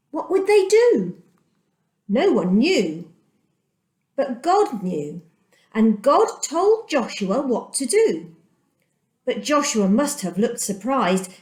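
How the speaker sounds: usual speaking voice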